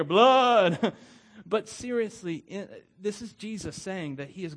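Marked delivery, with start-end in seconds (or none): 0.0-0.9 loud, high revival shout of Blood!; Blood! bellowed from the pulpit. Shown as a demonstration. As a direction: belt out Blood! like an old-time preacher. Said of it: "we still say blood around here".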